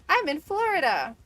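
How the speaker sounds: high, loud imitation of the caller; emphatic retold answer. Caller tells agent his location, emphatic imitation.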